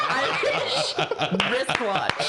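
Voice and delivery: laughing through the words. Real, not acted. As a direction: giggle out the tongue twister. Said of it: "I was so close".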